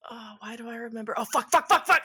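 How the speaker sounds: acted panicked realization, climbing fast; mounting panic. Character panics as memory returns.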